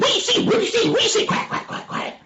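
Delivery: high squeaky animal chatter; small animals clamouring. Acted. Short animals beg to see in window, high squeaky clamour. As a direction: squeal it up high like eager little creatures.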